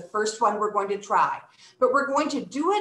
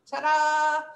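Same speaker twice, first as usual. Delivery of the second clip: playful reveal; 5 semitones higher than usual. Pretend. Announces the wheel's result.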